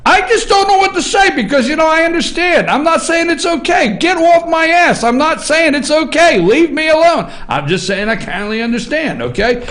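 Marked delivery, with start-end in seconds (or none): 0.1-1.7 exasperated shout. Real, not acted. Host rants at his audience. 4.0-5.0 defensive retort. Real, not acted. Host snaps back at critics. 6.4-7.3 defensive retort. Real, not acted. Host snaps back at critics.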